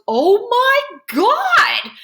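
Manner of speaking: very high, loud, drawn-out shriek; whooping shriek. A narrator's reading. Freak cracks up at his friend, whooping shriek. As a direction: stretch each word in a high whoop. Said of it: "he's shrieking and laughing and whooping it up".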